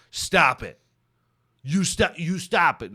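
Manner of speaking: loud, playful telling-off; mock scolding. Host mock scolds fans over too many DMs, playful telling-off. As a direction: pretend to scold the fans, half-joking.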